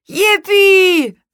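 high, loud, drawn-out cheer; cheering call. A narrator's reading. Boy sees his kite fly up, loud high cheer. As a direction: whoop it out high. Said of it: "rief Luis".

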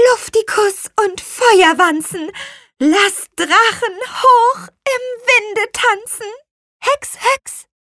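slow incantation rising to high, loud chant; magic spell. A narrator's reading. Little witch conjures wind for the kite, slow chanted spell climbing high. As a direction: build the rhyme slowly from normal to a high call. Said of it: "den Zauberspruch ausgesprochen".

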